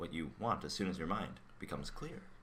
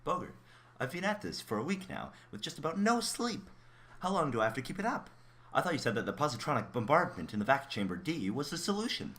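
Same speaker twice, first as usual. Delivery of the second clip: agitated; 6 semitones higher, 3 dB louder than usual. Acted. Exhausted colleague complains, pressing.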